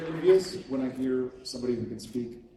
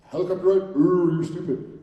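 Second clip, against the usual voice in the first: mocking; 3 semitones higher, 8 dB louder than usual. Mocking friend's idea loudly.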